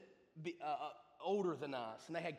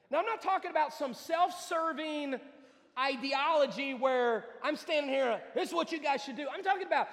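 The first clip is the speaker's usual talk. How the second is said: full-force preaching; 9 semitones higher, 11 dB louder than usual. Real, not acted. Preacher urges heeding a message from God, full-force preaching. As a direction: build hard, then hammer out the last words.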